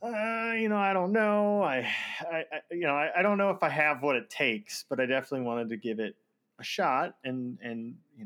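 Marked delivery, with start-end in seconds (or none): 0.0-4.8 mock timid, unsure voice; hesitant, lightly hammed-up nervous self; nervous self-quote. To the devs, sending the tryout, timid unsure voice. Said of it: "'cause I was like".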